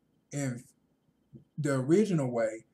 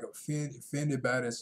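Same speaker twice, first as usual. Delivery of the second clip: ordinary talk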